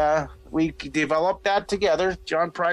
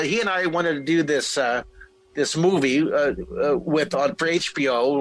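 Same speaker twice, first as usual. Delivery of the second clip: ordinary delivery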